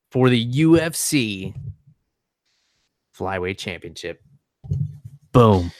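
slow announcer with long dramatic pauses; broadcaster voice. Pretend. Selling a title fight to an unimpressed co-host, mock broadcaster voice. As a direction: announce the title fight in measured, separate chunks. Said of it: "with my broadcaster voice".

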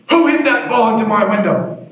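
high, booming teacher demand; stern principal. Retold or imitated. Principal confronting the boys over the broken window, stern shout. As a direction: bark it at the guilty kids.

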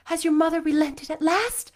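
hope rising in her voice; eager question. Acted. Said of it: "cried Anne".

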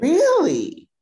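can't believe it; real surprise; surprised really. Real, not acted. Surprised reply to the group chat news.

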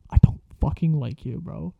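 unvoiced whisper, spiteful under the breath; whispered grumble. Signing classmates' papers, whispered spiteful grumble. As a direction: hiss it so nobody hears.

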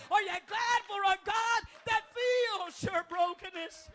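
fervent sermon climax, hammering each line; preaching at full force. Acted. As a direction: whip the congregation up.

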